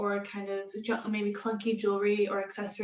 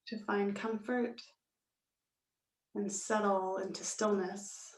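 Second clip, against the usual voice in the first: slow, measured yoga nidra guidance with long pauses; guided meditation. Real, not acted. Yoga teacher settling students into Shavasana, slow calm meditation voice. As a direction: ease them into stillness, unhurried and calm.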